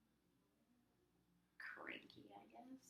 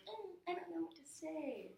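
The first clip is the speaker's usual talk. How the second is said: higher, helpless shrug of a voice; imitating a friend; 11 semitones higher, 3 dB quieter than usual. Friend avoiding sides, higher helpless voice. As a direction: play the stuck friend who won't pick sides. Friends unsure which side.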